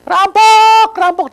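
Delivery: high, loud cry of thief; a retold cry of alarm, high and loud; yelling for help. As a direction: reenact shouting rampok for help. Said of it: "Aku sampai teriak-teriak".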